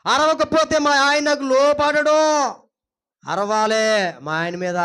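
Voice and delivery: mock wife's loud, slow complaint; hammed-up nagging wife; yelling wife. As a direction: insist at full volume, drawn out. Acts a wife who must yell.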